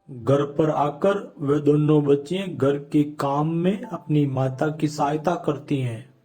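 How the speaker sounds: ordinary delivery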